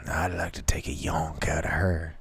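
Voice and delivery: whispered naughty aside; cheeky whisper. Pretend. Testing a slang word on her friend, cheeky whisper. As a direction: breathe it out as a secret joke.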